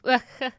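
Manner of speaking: quick burst of laughter; a bright little laugh; short laugh. Real, not acted. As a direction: laugh at your own joke. Laughs at own joke.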